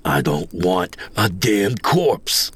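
slow, controlled growl. A narrator's reading. Greenhorn refuses Coyote with hackles rising, slow growl. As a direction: growl each word slowly through rising bile. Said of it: "I growled".

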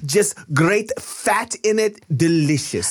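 high, hammed-up Italian salesman accent. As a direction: sell it like a showy Italian deli man.

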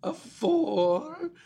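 high, hissing vampire count; hissing feral vampire. Pretend. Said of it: "count to ten in your best, uh, vampire voice".

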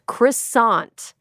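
Croissant as kriss-AHNT, with a hard final t; deliberately un-French pronunciation. Shown as a demonstration. Host refusing any French accent, Croissant pronounced flatly English as kriss-AHNT. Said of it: "no effort to pronounce things in a French accent".

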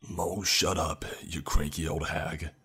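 mildly booming retort; a touch of fatherly boom. A narrator's reading. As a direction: shut him down with some force. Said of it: "My father's voice boomed back towards my furious granddad".

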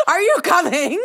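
asked again through laughter; laughing. Retold or imitated. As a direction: laugh while calling it out.

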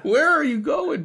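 normal talking voice

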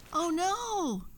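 fairy caught by the witch; cry of alarm. A narrator's reading. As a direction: gasp it out in sudden fright. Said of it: "she cried".

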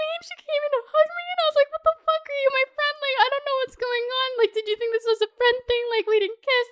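high-pitched, wailing dismay. Retold or imitated. Hugged by her date instead of kissed, dismayed. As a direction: squeal it out in comic hurt disbelief.

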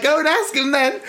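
lightly voiced mum; mock mum. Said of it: "Mum was like".

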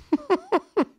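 short high laugh; quick, high burst of giggles; high-pitched giggle. Real, not acted. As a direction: giggle at your own flub. Laughing at own failed accent.

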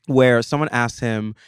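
normal talking voice

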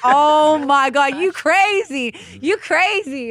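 loud, high Floridian accent imitation. Woman mimics her man's reaction to her shirt, Floridian accent. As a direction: draw out the start in a mock Floridian accent. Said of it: "in his Floridian accent".

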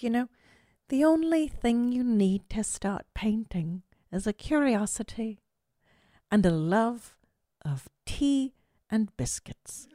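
put-on breathy hushed English gentleman accent. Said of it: "and he talks like this".

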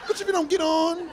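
high, sassy comeback; sassy indignant. Retold or imitated. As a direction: snap back with attitude.

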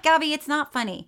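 high-pitched protest to someone filming; retold scolding. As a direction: re-enact an indignant complaint, half laughing. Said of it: "I'm like".

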